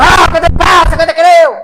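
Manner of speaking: high, loud mock challenge; imitating a rebellious member shouting at his pastor; defiant shout. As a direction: snap back as if ready to fight.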